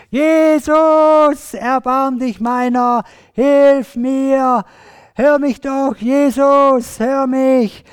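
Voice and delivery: high, loud, long-drawn pleading shouts; schreiendes Flehen. Acted. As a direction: holler for mercy, stretching every call. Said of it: "Der hat geschrien, was das Zeug hält". (aus voller Kehle flehend schreien)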